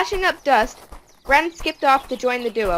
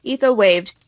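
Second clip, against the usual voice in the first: usual speaking voice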